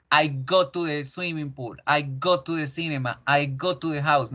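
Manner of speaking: English read with Spanish vowels and consonants; Spanish accent, "go" with a pure o. Shown as a demonstration. Teacher mimics students' sentences to avoid, Spanish accent. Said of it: "no los voy a escuchar nunca decir".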